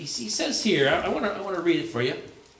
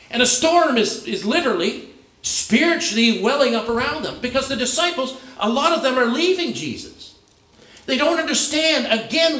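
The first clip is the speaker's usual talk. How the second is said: preaching higher and louder, building intensity; rising preaching; 7 semitones higher, 3 dB louder than usual. Acted. Pastor stirring up the congregation about disciples leaving, in a rising preaching voice. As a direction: swell up as the storm image builds.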